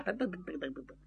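very soft rapid patter; hushed, rapid-fire running sound effect; imitated running feet. A narrator's reading. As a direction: tap out fading footsteps with the tongue.

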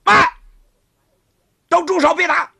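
loud, high shout to stop the fight; commanding shout. Acted. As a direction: halt them all with a sharp cry. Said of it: "那个白衣女人说话了".

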